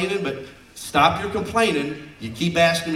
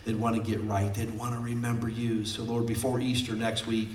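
closing prayer; 4 semitones lower than usual. Acted.

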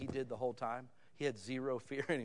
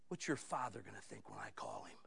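stern detective; 5 semitones lower, 8 dB quieter than usual. Retold or imitated. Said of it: "and he goes".

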